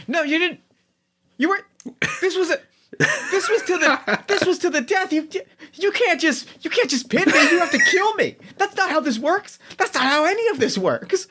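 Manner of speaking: high-pitched outraged protest, laughing through it; furious high yell. Acted. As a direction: squeal the outrage of a sore loser. GM voices furious defeated wrestler.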